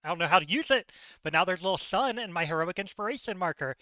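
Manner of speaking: ordinary talk, nothing marked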